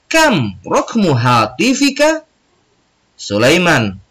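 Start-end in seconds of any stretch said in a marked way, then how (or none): 0.1-2.3 put-on high, slow, lilting boyish question; Ali asking. Ali asking Sulaiman his number, lightly voiced higher question.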